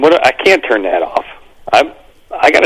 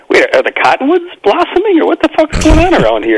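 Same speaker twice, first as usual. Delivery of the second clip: surprised; 14 semitones higher than usual. Retold or imitated. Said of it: "You'll be like".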